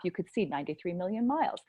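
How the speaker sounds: normal talking voice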